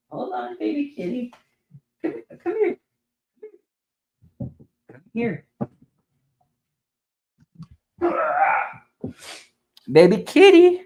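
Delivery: very high coo to a pet; high-pitched pet voice. Real, not acted. Owner coaxes the cat to come over, baby talk.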